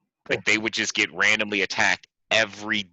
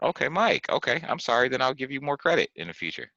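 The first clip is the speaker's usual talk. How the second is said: high-pitched, conceding apology; placating apology; 6 semitones higher than usual. Real, not acted. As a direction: give in with a higher-pitched "okay, okay, I'm sorry" tone. Overdone apology to doubted co-host.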